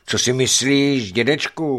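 put-on a boy stung by doubt about him; offended boy. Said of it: "urazil se hoch".

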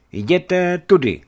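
high, loud, slow pointing the way; boyar's command. Acted. Boyar guides the guest out, loud slow command.

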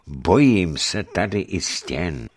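ordinary delivery, nothing marked

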